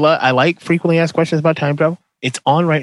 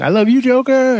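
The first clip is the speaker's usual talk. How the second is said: high, gushing catchphrase; catchphrase declaration; 10 semitones higher than usual. Shown as a demonstration. Host coining his new catchphrase, high and gushing. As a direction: sing out the new catchphrase lovingly. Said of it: "that's gonna be my new thing".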